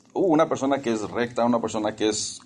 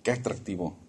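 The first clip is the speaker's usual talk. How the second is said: vain admiration; 3 semitones lower, 6 dB quieter, slower than usual. Retold or imitated. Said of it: "que dicen".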